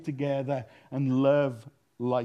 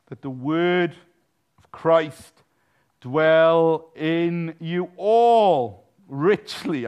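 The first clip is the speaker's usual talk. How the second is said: very slow, louder, each word stretched; drawn-out scripture; 7 dB louder, much slower than usual. Real, not acted. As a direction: savour every word. Savouring scripture phrase.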